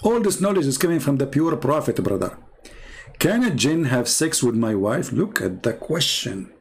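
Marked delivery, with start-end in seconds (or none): none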